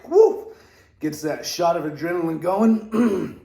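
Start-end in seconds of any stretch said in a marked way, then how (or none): 0.1-0.5 high loud whoop from the cold; cold shock whoop. Real, not acted. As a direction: yelp at the icy water.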